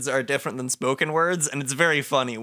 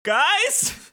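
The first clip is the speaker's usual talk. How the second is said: much higher, urgent questioning call; louder call; 3 dB louder than usual. Acted. Player calling to distracted friends, louder high call. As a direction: call out again, pitched way up. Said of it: "says the player louder".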